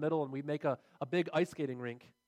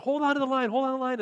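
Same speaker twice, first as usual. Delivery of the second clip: high, loud repeated command; a father shouting at his son to hold the fish; urgent shout; 6 semitones higher, 6 dB louder, faster than usual. Retold or imitated. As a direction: yell it so he won't let go. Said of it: "and he says".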